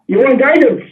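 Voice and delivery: usual speaking voice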